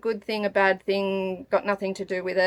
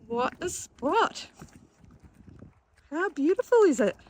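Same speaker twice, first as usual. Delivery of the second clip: excited; 8 semitones higher than usual. Real, not acted. First sight of the beach.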